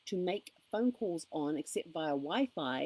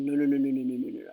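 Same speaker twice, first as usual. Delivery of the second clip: emphatic refusal; 4 semitones lower, 7 dB louder, faster than usual. Retold or imitated. Retold refusing the phone agent.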